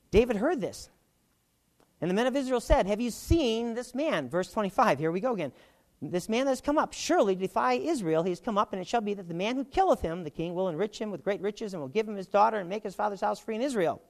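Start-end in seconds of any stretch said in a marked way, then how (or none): 2.0-14.0 raised, declaiming Bible reading voice; scripture reading. Acted. Teacher reading Jesse's words to the class, declaiming. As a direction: proclaim the verse to the room.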